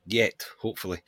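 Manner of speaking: normal talking voice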